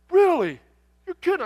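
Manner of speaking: loud, high-pitched disbelief; hammed-up amazed trucker; mock astonishment. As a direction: exclaim like a thrilled stranger. Trucker astonished by shared hometown.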